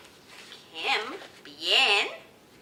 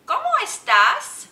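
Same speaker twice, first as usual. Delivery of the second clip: loud, high, projected question; big rising question to young learners; teacher quizzing children; 12 semitones higher, 13 dB louder than usual. Shown as a demonstration. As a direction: ask the whole class with gusto. Asks children the practised question.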